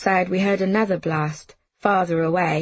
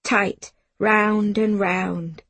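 ordinary delivery, nothing marked

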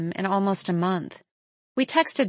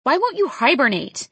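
raised, higher-pitched scolding; exasperated yell; 5 semitones higher, 5 dB louder than usual. A narrator's reading. Startled woman scolding the raccoon, exasperated yell. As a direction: yell it at the pesky animal. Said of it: "I yell through gritted teeth".

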